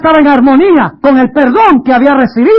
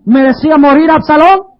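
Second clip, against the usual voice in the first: usual speaking voice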